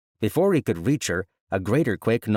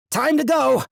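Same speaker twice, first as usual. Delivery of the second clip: loud, much higher urgent shout; 6 dB louder than usual. Acted. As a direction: shout to get them moving. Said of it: "Vex shouted".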